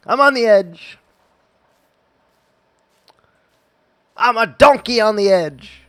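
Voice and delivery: hammed-up high, loud taunt; sneering panto villain. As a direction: camp it up like a stage villain. Playful voice, same line repeated.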